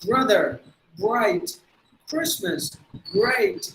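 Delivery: word by word, smooth American R, falling tone; American R accent. Shown as a demonstration. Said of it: "So that's how they would say". (அமெரிக்க உச்சரிப்பு)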